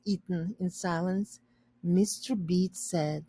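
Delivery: plain reading voice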